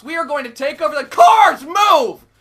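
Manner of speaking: loud battle commands; shouted orders. Real, not acted. Gamer yelling orders at his teammates, loud. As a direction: yell orders at the squad.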